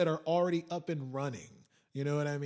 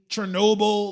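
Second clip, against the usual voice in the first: emphatic Chernobyl; 5 semitones higher, 11 dB louder than usual. Real, not acted. Shouted emphasis.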